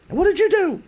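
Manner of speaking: high, loud demand; alarmed aunt. Retold or imitated. Aunt to nephew after hearing of advance, alarmed demand. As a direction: shriek the question as a panicked aunt.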